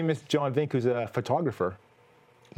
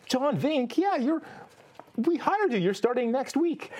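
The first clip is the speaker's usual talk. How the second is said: much higher, bright clerk voice; cheerful bank clerk; 8 semitones higher, slower than usual. Retold or imitated. Host imitates the bank clerk telling him he's hired, high-pitched. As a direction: play the bank clerk breaking good news. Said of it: "And they said".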